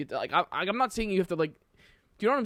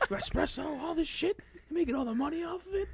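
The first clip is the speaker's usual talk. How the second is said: very high, indignant mock gangster; Paulie Walnuts; 13 semitones higher, 3 dB quieter than usual. Friend retelling coffee shop scene, high indignant mobster voice. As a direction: complain high like a wronged mobster. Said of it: "he goes".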